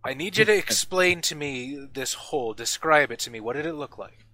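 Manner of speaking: put-on smith pressing for details, in his accent; Ardmore's accent.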